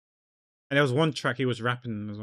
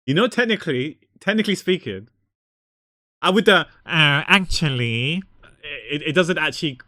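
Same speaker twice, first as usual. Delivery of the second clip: animated; 4 semitones higher, 6 dB louder than usual. Real, not acted. Correcting her own French.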